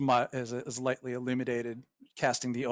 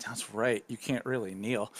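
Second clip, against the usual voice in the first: ordinary talk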